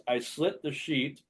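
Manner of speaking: slow, deliberate tongue twister; slow careful reading. Shown as a demonstration. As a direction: enunciate each word slowly. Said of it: "So the first one is".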